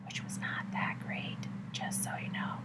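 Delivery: conspiratorial whisper; whispered aside. Real, not acted. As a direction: drop to a secret whisper, half-joking. Whispered aside about the hotel.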